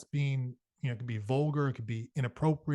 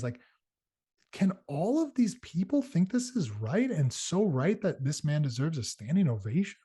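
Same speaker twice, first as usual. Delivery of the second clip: usual speaking voice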